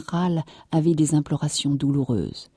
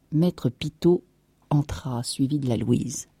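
plain reading voice

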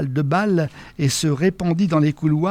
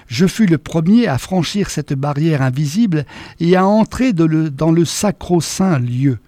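ordinary delivery

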